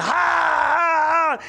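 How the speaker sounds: high, loud retold battle cry; rebel yell. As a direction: belt it out like soldiers cheering their general. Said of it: "All the men started screaming".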